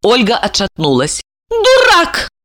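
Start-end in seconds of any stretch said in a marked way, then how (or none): 1.5-2.3 high, aghast exclamation; appalled insult. A narrator's reading. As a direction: hurl the word as you recoil. Stepmother recoils at his confession.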